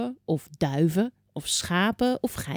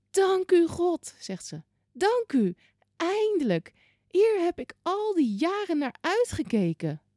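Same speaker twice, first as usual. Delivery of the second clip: put-on high, quivering voice of a very old woman; 11 semitones higher than usual. Hundred-year-old Hanna thanking God in the temple, high and joyful.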